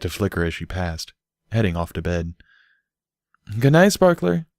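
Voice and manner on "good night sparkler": high call down the hall; bright called goodnight. A narrator's reading. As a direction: call goodnight across the house. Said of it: "Derpy called".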